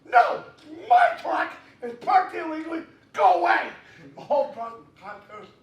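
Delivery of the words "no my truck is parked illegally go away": loud, stubborn shout with a laugh in it; shouted refusal. Retold or imitated. Loud mock refusal to con security. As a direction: yell at con security that it's your truck. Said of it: "So I went".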